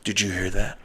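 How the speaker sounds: low, hushed scared question; mock frightened hush. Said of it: "they go".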